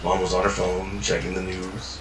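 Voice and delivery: ordinary delivery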